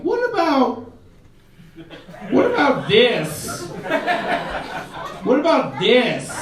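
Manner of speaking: high, laughing disbelief; incredulous mock question. Comic asking the woman what she sees in him, incredulous. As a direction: ask it like you can't believe it. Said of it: "I'm like".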